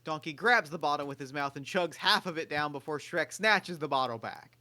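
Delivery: usual speaking voice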